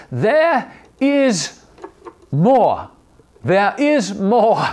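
high, drawn-out Australian accent impression. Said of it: "in his Australian accent".

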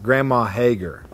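usual speaking voice

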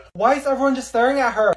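normal talking voice

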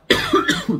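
sudden dry cough; a single sharp cough from a tickly throat. Real, not acted. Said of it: "I have a tickle in my throat".

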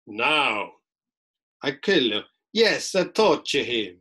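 mock-menacing Pope in full power; powerful Pope. Lecturer plays the Pope once robed, powerful and menacing. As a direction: proclaim it firmly, in quotation marks. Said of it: "I was playing him in quotation marks".

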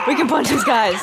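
loud, high, delighted exclaim; excited yell. Real, not acted. Gamer thrilled she can punch enemies, loud delighted shout. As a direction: shout the discovery with glee.

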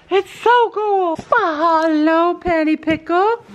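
high, slow cooing. Real, not acted. Vlogger greets her horse Penny in high baby talk. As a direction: coo at the horse fondly.

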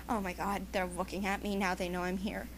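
low, very soft muttered worry; hushed aside. Acted. Hiding and afraid they know, hushed aside. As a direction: keep it down, as if trying not to be noticed.

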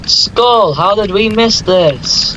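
high, drawn-out amazement; stunned discovery, stretched out high; astonished. Real, not acted. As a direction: exclaim slowly in high-pitched disbelief. Gasps on spotting a skull.

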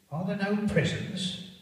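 high, loud scornful retort; scornful Scrooge. Acted. Scrooge scoffing at the charity workers, loud and scornful. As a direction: sneer the questions back at them.

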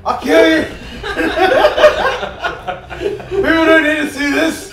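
yelling while laughing; loud, laughing outrage; laughing shout. Real, not acted. Friend posted his bad play.

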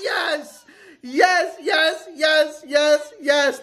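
high, shouted yes over and over; thrilled win scream; ecstatic cheering. Real, not acted. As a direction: cheer like you just won big. Celebrating a win.

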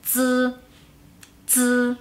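"z" as a held, buzzing dz with no puff of air; Mandarin Z. Shown as a demonstration. Teacher showing learners how Z sounds alone, "z" as a vibrating dz. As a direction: say "z" like the end of English kids, drawn out. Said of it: "En chino la Z sola se pronuncia".

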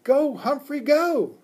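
high, loud rooting cheer; excited high-pitched child's cheer. A narrator's reading. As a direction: cheer the little climber on. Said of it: "Simon cheered me on".

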